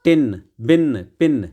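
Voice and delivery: each rhyming word said separately; rhyme demo. Shown as a demonstration. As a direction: sound out the vowel in each. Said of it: "तो आई की sound इसमें आ रही है".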